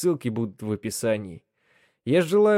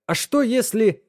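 испуганно; 11 semitones higher than usual. Acted. Испуганный вопрос в машине времени.

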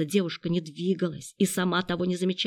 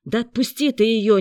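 loud, higher-pitched protest; raised-voice indignant order; exasperated outburst; 4 semitones higher, 7 dB louder than usual. A narrator's reading. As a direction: burst out, unable to stand it any longer. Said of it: "не выдержал Сашинский".

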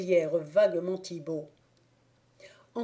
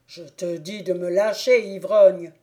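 loud, the insult barked; angry stranger; 6 dB louder than usual. Acted. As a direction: spit out the insult.

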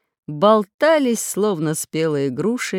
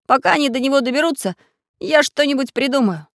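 quick, higher-pitched resolve; decisive; 7 semitones higher, 4 dB louder, faster than usual. A narrator's reading. Leader promises friends he'll think of something, decisively. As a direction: take charge at a rapid clip. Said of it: "решительно приказал Самоделкин".

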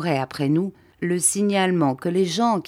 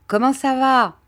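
Bob asking; 9 semitones higher, 5 dB louder than usual. Acted. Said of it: "me demanda Bob en se tournant".

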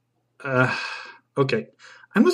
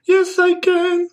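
put-on squeaky-high girl's singsong reply; high-pitched girl; 4 dB louder, slower than usual. Girl in the pronunciation song, high-pitched voice. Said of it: "with a high-pitched voice".

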